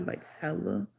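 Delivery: plain reading voice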